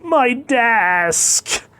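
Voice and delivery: high, loud, drawn-out cry; panicked yell. Acted. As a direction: shout it high in alarm. Producer yells as desk is trampled.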